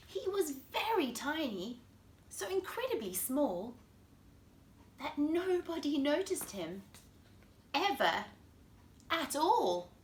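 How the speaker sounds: put-on lively storybook voice for kids; reading to children. A narrator's reading. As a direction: read it like bedtime story time. Reads picture book to children.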